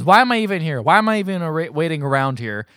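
high, loud mock outburst, easing off at the end; acted-out angry complaint; retold anger. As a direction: protest as the fed-up woman in the story. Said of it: "Alma gets mad and says".

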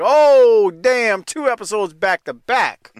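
loud high oh damn; overjoyed shock at a TV twist; excited disbelief. Retold or imitated. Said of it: "I was like".

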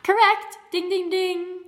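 high, loud winning announcement; game show host. Pretend. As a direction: ring it out like a bell. Game show host confirms the answer.

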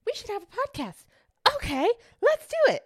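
high, bright eager quote; excited. Retold or imitated. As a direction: pitch it up like a giddy idea. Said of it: "and we were like".

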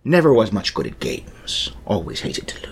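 understated sea-dog menace; pirate voice. Acted. Pirate admitting he hates losing, pirate voice. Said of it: "I'm gonna do a pirate voice".

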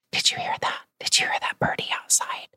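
soft, secretive whisper about the bird; hushed question to a little kid; whisper to a child. Shown as a demonstration. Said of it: "I might go quiet".

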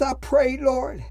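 normal talking voice

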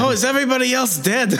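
quick high stage-Irish voice; Banshee Irish brogue. Retold or imitated. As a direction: rattle it off in a squeaky stage Irish accent. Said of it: "I just did an impression of".

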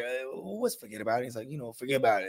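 lightly voiced mobster, low and soft; a hint of a movie gangster explaining; quoting the movie. Imitating the mobster explaining the phrase to a friend. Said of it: "a chop from that movie".

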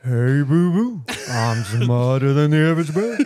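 goofy cartoon bear voice; bad cartoon bear impression; Yogi Bear. Trying to beat the co-host's attempt, cartoon bear voice. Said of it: "Yogi Bear".

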